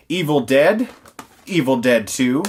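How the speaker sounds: ordinary talk, nothing marked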